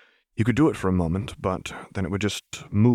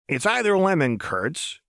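high-pitched, lilting offer; chirpy mischief; Jinx, playful; 9 semitones higher than usual. A narrator's reading. Jinx offers a suspect taste test.

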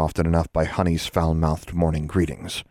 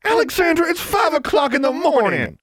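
very high, loud exasperated shout; 4 dB louder than usual. Acted. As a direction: yell through the wall, fed up. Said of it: "both shouted from the next room".

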